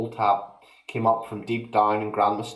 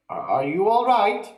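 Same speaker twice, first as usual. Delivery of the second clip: higher, mock-polite boyish ask; polite question; 9 semitones higher, 3 dB louder than usual. A narrator's reading. George asking grandma politely, higher. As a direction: ask sweetly, feigning concern.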